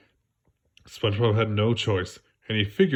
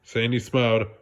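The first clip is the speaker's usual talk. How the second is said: usual speaking voice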